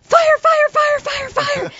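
very high, rapid repeated fire calls, fading at the end; frantic yelling. Retold or imitated. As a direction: keep shrieking fire over and over.

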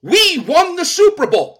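mocking fan. Mocking Philly fans' "we.

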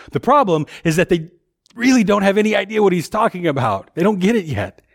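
ordinary delivery

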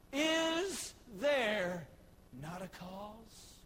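David asks the soldiers, slow and weighty; slow weighty question. Retold or imitated. Preacher voicing David, drawn out and fading.

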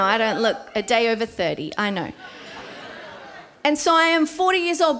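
ordinary talk, nothing marked

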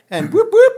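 high whooping police siren noise; mock siren, high and loud; siren imitation. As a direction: yelp like a cop siren.

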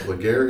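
Laguerre ending in "-gair"; spelling-based mispronunciation. Shown as a demonstration. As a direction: say Laguerre as it looks, rhyming with "air". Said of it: "but it is pronounced".

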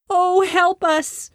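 high, slow, pleading call; a desperate drawn-out plea; cried for help. A narrator's reading. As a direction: sisters and farmer crying for help, frightened. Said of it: "cried his grandfather and the startled woman".

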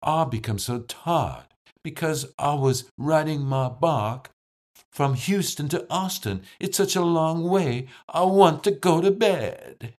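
Southern accent with long I's as "ah", rising in pitch. Shown as a demonstration. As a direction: play a tired Texan in a Southern accent. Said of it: "In a Southern accent".

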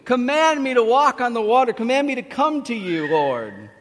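loud, high plea falling on the last word; bold plea. Acted. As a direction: call out boldly as Peter to his Lord. Acting out Peter's bold plea.

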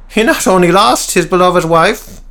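high, sharp needling question; high voiced Cecil. A narrator's reading. Cecil needling Peter, high and sharp. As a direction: jab at him in a high voice. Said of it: "added Cecil".

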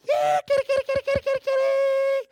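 very high, loud sing-song cat call; high singing call for the kitty; mom calling the cat. Retold or imitated. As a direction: call the cat across the yard. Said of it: "call the cat".

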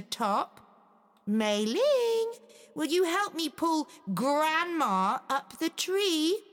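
put-on high, innocent little girl's apology; mock-innocent girl to the wolf; sweet girl voice. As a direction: play the sweet girl tricking Grandma.

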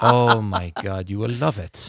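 swooning mock French accent. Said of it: "our French guy".

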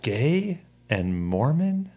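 slow, puzzled mother; echoing each word, working out what it means. Retold or imitated. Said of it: "trying to, like, think, like, figure out what the words meant".

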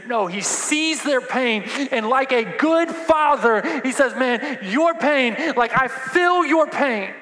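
high, loud, slow preacher's cry; preaching at full force. Acted. Pastor to congregation, loud slow preaching cry. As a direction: lean into it like a grieving father.

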